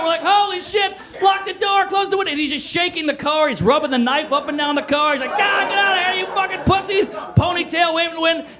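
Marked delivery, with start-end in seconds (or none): 0.2-2.3 high-pitched frantic mock alarm; panicked. Teens trapped in car by attacker, high panic. 5.4-7.1 high, strained mock rage; enraged yelling. Knife man shaking the teens' car, high raging yell.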